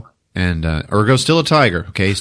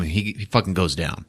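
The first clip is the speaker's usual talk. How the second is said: ordinary talk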